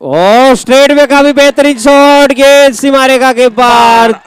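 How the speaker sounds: high, loud, excited shot call; excited cricket commentary. Real, not acted. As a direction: call the boundary with rising excitement. Live boundary commentary.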